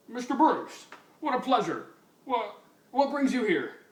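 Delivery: high, eager fawning voice; fawning commissioner. Acted. Commissioner greeting the rich donor, high fawning voice.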